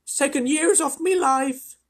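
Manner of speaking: frazzled ladybird complaining after the trip; old ladybird voice. A narrator's reading. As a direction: wail it like a fussy old bug. Ladybird voice continues.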